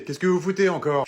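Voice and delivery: normal talking voice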